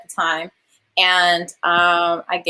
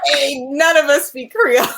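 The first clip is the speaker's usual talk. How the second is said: alarmed; 6 semitones higher, 3 dB louder than usual. Retold or imitated. Crew's alarmed reaction.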